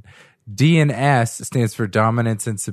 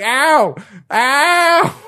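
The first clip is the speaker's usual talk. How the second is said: high, loud mock wail of ow; hammed-up cry of pain; 5 dB louder than usual. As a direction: wail it like a bad porn actor. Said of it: "And he's like".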